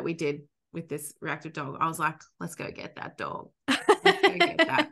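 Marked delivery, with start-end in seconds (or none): 2.4-3.5 hushed, breathy egging-on with no voice; low conspiratorial whisper to the dog; whispered urging. Retold or imitated. Urging his dog toward trigger. 3.7-4.9 higher, slow, sing-song urging; drawn-out cheeky dare; playful egging-on. Retold or imitated. Urging his dog toward trigger.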